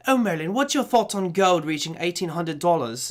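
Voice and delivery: imitated Australian TV presenter; Australian accent. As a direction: ask it in a put-on Australian accent. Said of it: "this lovely Australian accent".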